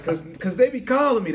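ordinary talk

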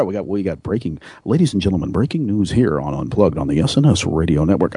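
low, manly newscaster read; deep news voice. Retold or imitated. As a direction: drop into a deep anchor voice. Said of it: "my deep, manly news voice".

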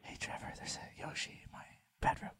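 unvoiced prank whisper. Prankster whispering to the Nintendo agent, hushed. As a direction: breathe it to the phone agent.

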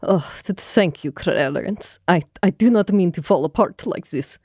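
put-on subdued, halting thanks in an accent; recovering from tears, lightly acted; hesitant accented apology; put-on tearfulness. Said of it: "So she starts crying".

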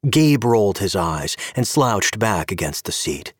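ordinary delivery

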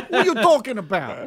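hammed-up New Jersey accent. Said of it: "That's New Jersey".

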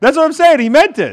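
very high, loud yell; heated shouting. Real, not acted. Heated mock argument over story.

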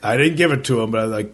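normal talking voice

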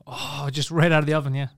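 usual speaking voice

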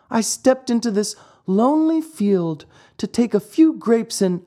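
put-on high puppet-boy voice; Pinocchio explaining. Trapped puppet explains to the glowworm, high-voiced. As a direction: explain in a high little voice, cut off.

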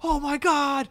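high-pitched mock panic; hammed-up horrified cry; imitated freak-out. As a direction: wail it like a dad freaking out. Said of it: "he started freaking out".

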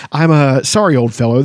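ordinary talk, nothing marked

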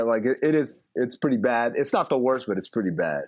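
ordinary talk

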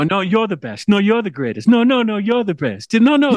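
high, gushing mock flattery; gushing bromance. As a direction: gush like a lovestruck bro. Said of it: "pretty much living a bromance".

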